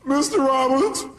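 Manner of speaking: high, loud, slow whiny woman; hammed-up pleading caller; caller's voice. Caller pleading with Robbins on radio, high whine. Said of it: "this woman calls up and she goes".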